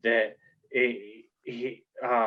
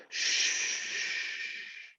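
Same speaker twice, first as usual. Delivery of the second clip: soft unvoiced shh; shushing; 9 dB quieter than usual. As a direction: hush them quietly. [name] hushing.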